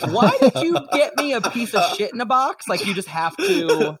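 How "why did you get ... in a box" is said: higher, hammed-up improv complaint with laughter; heavy-handed improv. Shown as a demonstration. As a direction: overplay a mock daughter's grievance. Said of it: "you have to be so heavy-handed and inelegant".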